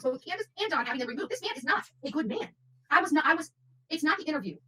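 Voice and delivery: ordinary talk, nothing marked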